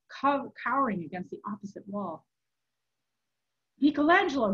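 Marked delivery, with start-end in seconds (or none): 3.8-4.5 loud, higher call; crying out. A narrator's reading. Cowering man calls to the monk, crying out. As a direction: cry out the name urgently. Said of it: "he cried".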